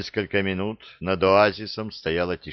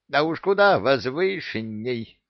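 moaned; 6 semitones higher than usual. A narrator's reading. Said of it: "простонала четвёртая".

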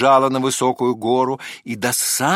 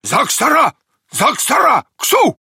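high, loud cries in dog language; dog's shout; 13 semitones higher, 6 dB louder than usual. A narrator's reading. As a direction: yelp out the commands, climbing higher. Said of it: "крикнула". (громкий высокий собачий крик)

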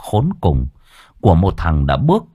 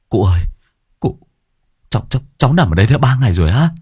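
put-on young man panicking to the old man; panicked stammer; 3 semitones higher, much faster than usual. A narrator's reading. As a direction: tumble out the words in panic. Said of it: "hốt hoảng".

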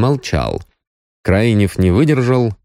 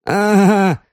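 high-pitched, scoffing disbelief; sceptical snort; 13 semitones higher than usual. A narrator's reading. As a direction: scoff at a friend's claim. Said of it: "хмыкнул Пищалов".

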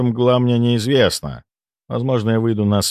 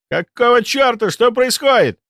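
high alarmed shout; 4 dB louder than usual. Acted. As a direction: yell it out in alarm. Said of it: "закричал кто-то".

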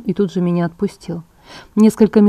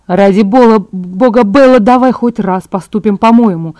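louder, tense exasperation; through gritted teeth; 7 dB louder than usual. A narrator's reading. Edward snaps at Bella to do it his way, exasperated. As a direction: force it out through clenched teeth. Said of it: "процедил Эдвард сквозь зубы".

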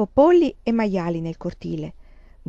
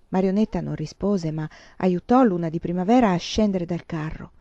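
ordinary delivery, nothing marked

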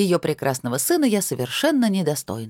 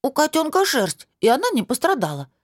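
put-on high child voice, У котёнка said matter-of-factly; a small girl correcting in earnest; businesslike; 9 semitones higher than usual. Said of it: "деловито ответила малявка".

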